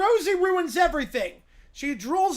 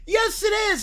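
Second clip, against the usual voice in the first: insistent shout; 5 semitones higher, 5 dB louder than usual. Acted. Insisting on fake holiday.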